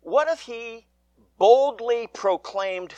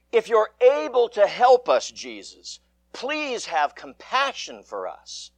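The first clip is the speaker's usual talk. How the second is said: pleading father; 5 semitones higher than usual. Acted. Father begging Jesus to heal his son, pleading. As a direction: beg Jesus as the anxious father. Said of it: "the father pleaded".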